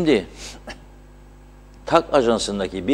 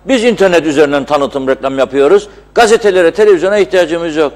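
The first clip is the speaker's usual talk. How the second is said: mocking quote; 6 semitones higher, 8 dB louder than usual. Host mocks big companies' claim.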